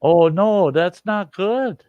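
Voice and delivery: high, slow shocked oh no; shocked dismay. Real, not acted. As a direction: react in disbelief, drawn out. Reacting to terrible upload speed.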